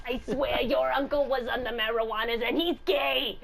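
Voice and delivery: put-on little old lady voice. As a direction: cackle through a granny line. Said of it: "my little old lady voice".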